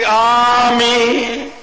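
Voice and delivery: long, drawn-out, ringing amen; loud amen. Acted. Habit slips out in another sect's mosque, loud amen. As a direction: let the amen burst out, held long. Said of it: "निकल गई मेरे मुंह से जोर से".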